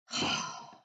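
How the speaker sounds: audible voiceless exhale; breathy, put-upon huff; big sigh. Acted. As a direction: sigh heavily at a friend. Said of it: "I did a big sigh".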